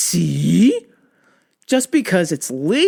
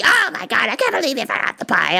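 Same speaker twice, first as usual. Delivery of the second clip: mom's scolding; 5 semitones higher than usual. Retold or imitated. Mom scolding about the pie.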